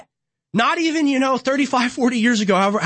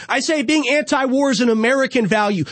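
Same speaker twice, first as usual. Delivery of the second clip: ordinary talk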